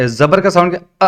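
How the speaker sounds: ordinary delivery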